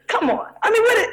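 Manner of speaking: ordinary talk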